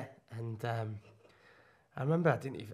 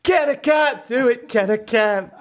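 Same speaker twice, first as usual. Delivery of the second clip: high, loud anguished soap wail; Deirdre Barlow; 6 semitones higher, 6 dB louder, slower than usual. Acted. Soap character protests to her husband, high shouted impersonation. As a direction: wail it like a melodramatic soap wife. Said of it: "Deirdre Barlow".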